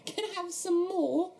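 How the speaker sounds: high, slow, pleading orphan; put-on little beggar voice, very high; quirky high-pitched voice. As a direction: beg in a quirky, squeaky-high British voice. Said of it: "high-pitched voices".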